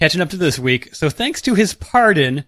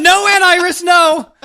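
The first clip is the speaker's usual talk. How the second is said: put-on high, loud panicked teen; panicked teenage boy; 13 semitones higher, 6 dB louder than usual. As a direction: squeal it like a caught teenager. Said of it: "acted teen Wally answering aunt".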